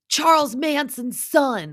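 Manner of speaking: slow, high mock battle cry; hammed-up yell, drawn out and loud; Sandoval. Sandoval smashing the wall, imitated high yell.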